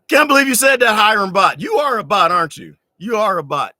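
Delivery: loud, high teasing disbelief; playful accusation. Real, not acted. He teases a commenter named Hiram Bot, loud playful disbelief. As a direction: call the commenter out with a grin.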